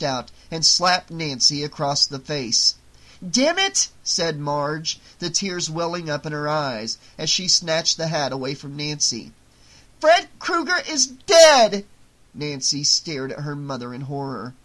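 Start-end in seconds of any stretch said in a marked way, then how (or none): 3.2-3.9 high, loud burst of frustration; angry outburst. Acted. Marge after slapping her daughter, loud tearful outburst. 10.0-11.8 very loud, high, slow shout; tearful shout. Acted. Marge snatching the hat from her daughter, tearful shout.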